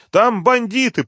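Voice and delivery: very high, urgent protest; alarmed, pitched far up; desperate explaining. A narrator's reading. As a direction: plead to be believed. Sveta desperately trying to explain.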